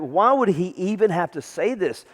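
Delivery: normal talking voice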